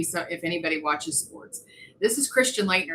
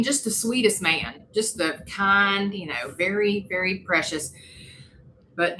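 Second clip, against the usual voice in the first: ordinary talk, nothing marked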